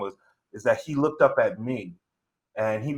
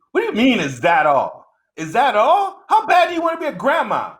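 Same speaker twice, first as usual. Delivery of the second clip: higher, louder, disbelieving outburst; incredulous yelling; 12 semitones higher, 7 dB louder than usual. Retold or imitated. Son yelling at his mother in disbelief over the phone. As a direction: shout back in disbelief, rising.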